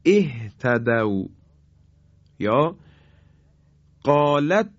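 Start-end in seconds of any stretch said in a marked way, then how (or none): 0.0-1.2 اهتدوا said as "ehtadaw", opening on e; kasra start. Shown as a demonstration. Teacher shows the standalone start to students, slow. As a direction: begin اهتدوا with a clear kasra e.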